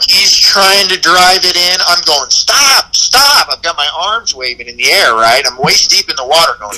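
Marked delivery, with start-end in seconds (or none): 2.3-3.5 retold yell. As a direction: yell stop at the drunk driving the boat. Yelling at drunk boat driver.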